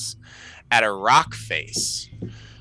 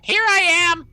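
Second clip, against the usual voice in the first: very high, loud squawking bird; crow voice; 7 dB louder, faster than usual. Acted. Game master voices crows calling to the players, high squawk. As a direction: squawk it far above the normal voice. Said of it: "the birds are saying".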